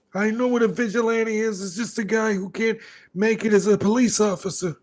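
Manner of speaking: high mock cop voice all through; hammed-up high cop voice; cop impression. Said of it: "I could do his voice like this".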